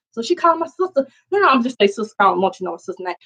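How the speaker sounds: ordinary talk, nothing marked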